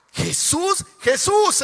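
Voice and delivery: exclaiming. Real, not acted. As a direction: call out the name with growing fervour. Preacher exclaiming about Jesus' zeal.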